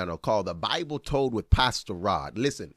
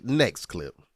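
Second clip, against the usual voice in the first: usual speaking voice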